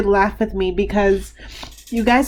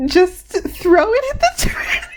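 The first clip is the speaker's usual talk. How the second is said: slow, high words collapsing into laughter; real laughter overtaking the sentence; laughing hard; 12 semitones higher, slower than usual. Real, not acted. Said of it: "I've never laughed that hard".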